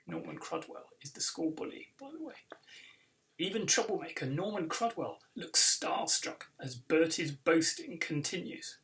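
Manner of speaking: usual speaking voice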